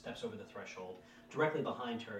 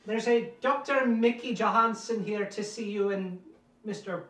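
calling upstairs; 13 semitones higher, 16 dB louder than usual. Acted. Housekeeper calling upstairs to the maid, loud and high. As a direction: call up to the maid. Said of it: "Lottie comes to the stairs and goes".

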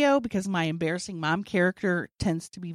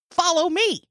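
higher, louder order; rally the photographer after you; bossy command; 9 semitones higher, 5 dB louder than usual. Retold or imitated.

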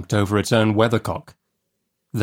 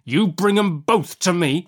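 higher, mounting convict demand; menacing convict; 9 semitones higher than usual. Acted. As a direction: push the order harder, pitched up.

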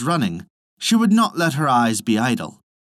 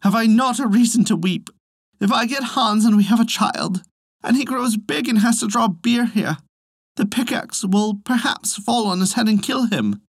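higher, a touch tearful; lightly voiced fretting Elsie; mild weepy lament; 8 semitones higher than usual. A narrator's reading. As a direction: plead your reason to weep. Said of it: "she answered".

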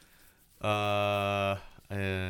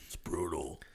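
deep metal growl; 7 semitones lower, 8 dB quieter than usual. Pretend.

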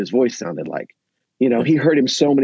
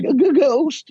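much higher, frightened cartoon yelp; scared Scooby and Shaggy; 13 semitones higher, slower than usual. Disciples turned cartoon cowards spot a ghost, frightened high yelp. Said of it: "now they've turned into Scooby and Shaggy".

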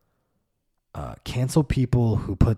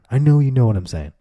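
usual speaking voice

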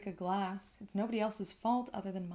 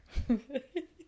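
laugh; 6 semitones higher, 4 dB louder than usual. Real, not acted. Laughing at her plan.

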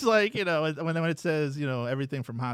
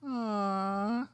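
mock sigh; 7 semitones higher than usual. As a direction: coo it like a fond parent. Voices parent finding sleeping kid.

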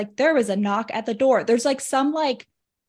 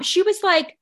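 normal talking voice

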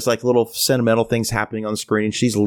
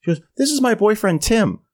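imitating her in a higher pitch; cheery introduction; 8 semitones higher than usual. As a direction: breezily present the boyfriend, pitched up. She introduces her boyfriend.

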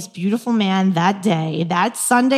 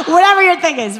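giggling high through the words; laughing; 9 semitones higher, 6 dB louder, faster than usual. Real, not acted. Joking with girls about nicknames, laughing higher and louder. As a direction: laugh while you say it.

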